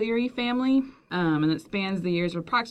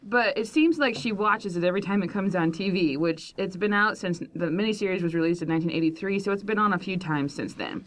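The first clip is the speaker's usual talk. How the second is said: ordinary talk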